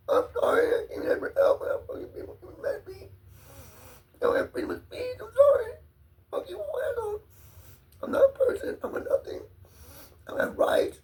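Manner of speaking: broken, sobbing high rant; crying. Real, not acted. Ranting about his rights while crying, high and sobbing. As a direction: choke it out through tears.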